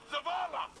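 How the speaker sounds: high urgent call for help. Acted. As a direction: yell his name across the field. Calling the commander for help.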